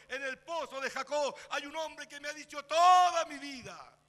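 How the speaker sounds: loud, breathless announcement of news; excited shout. Retold or imitated. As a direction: call it out to the whole village. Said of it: "salió gritando". (grito emocionado anunciando al pueblo)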